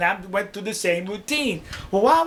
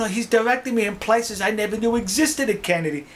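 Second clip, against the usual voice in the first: plain reading voice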